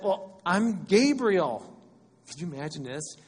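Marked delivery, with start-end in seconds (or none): 0.0-1.8 loud, raised, drawn-out announcement; grand, slow angelic declaration; imposing angel. Acted. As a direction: proclaim your name like a mighty angel. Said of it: "And the angel said".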